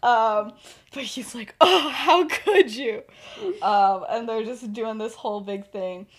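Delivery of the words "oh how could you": loud, high, drawn-out feigned outrage; mock betrayal. Sirius accusing his friend in a fake fight, mock betrayal. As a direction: wail it slowly, like a ham actor.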